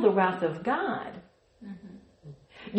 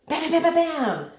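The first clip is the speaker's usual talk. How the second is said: mock punch sounds; 7 semitones higher than usual. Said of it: "good with my sound effects".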